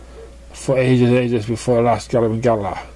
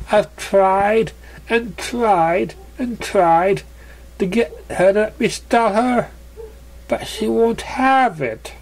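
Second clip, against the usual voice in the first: voiced pony; 10 semitones higher, slower than usual. Character complaining about friend.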